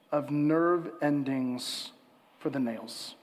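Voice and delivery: slow, measured, low reflective reading. Real, not acted. Preacher asks listeners to close eyes and think, slow reflective reading. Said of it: "I'm gonna read it slowly".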